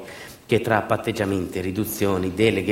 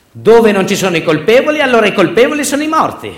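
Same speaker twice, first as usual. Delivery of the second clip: much higher and louder, a raw outraged shout; grandfather's bitter cry; 12 semitones higher, 12 dB louder than usual. Retold or imitated. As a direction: hurl grandpa's words out at full voice. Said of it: "diceva nonno".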